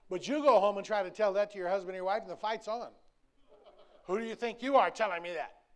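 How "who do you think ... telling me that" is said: loud, offended spouse snapping back; indignant spouse. Acted. Husband or wife firing back at the one preaching at home, loudly indignant. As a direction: bristle at being lectured.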